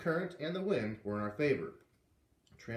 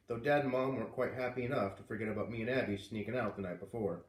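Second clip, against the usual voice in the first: ordinary delivery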